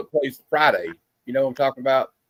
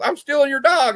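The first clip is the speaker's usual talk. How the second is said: high comic cop line; mock cop voice; 12 semitones higher than usual. A skit cop taking the dog, high mock voice. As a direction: squeak it out like a silly skit cop. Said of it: "he goes".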